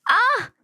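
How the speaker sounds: high sharp cry of pain. A narrator's reading. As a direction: yelp as her hair is grabbed. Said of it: "마지막 말을 끝내지 못하고". (머리채 잡힌 비명)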